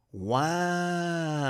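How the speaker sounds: long, soft, drawn-out wow; stunned wow. Real, not acted. Reacting to the testimony just read, stunned drawn-out wow. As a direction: stretch it out in quiet disbelief.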